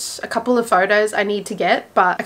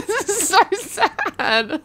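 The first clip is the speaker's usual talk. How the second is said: giggling through mock tears, slow and higher; laughing so hard it turns to tears; laugh-crying; 6 semitones higher, slower than usual. Real, not acted. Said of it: "I'm crying".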